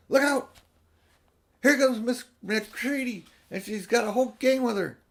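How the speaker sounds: loud, high excited alarm; urgent warning. Acted. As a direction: shout the warning as you rush in. Girls rush in warning.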